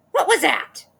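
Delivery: high, loud shocked cry; startled cry. Acted. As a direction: Mrs Twit yelps in shock. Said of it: "cried Missus Twit".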